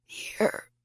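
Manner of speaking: faint croak. Acted. Weak answer to searchers.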